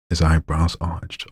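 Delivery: ordinary delivery, nothing marked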